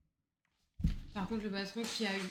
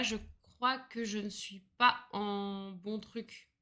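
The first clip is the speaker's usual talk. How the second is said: ordinary delivery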